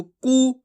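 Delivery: कू with a long "oo"; drawn-out vowel. Shown as a demonstration. Teacher modelling the long oo matra, drawn out. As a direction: stretch कू out. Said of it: "खींच के पढ़ा जाएगा इसको".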